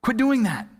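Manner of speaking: hammed-up husband losing his patience; angry, frustrated. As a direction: snap back, building to a high shout. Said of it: "kinda angry, frustrated thing".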